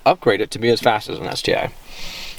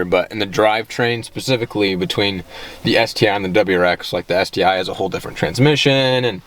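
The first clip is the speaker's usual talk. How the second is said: ordinary talk, nothing marked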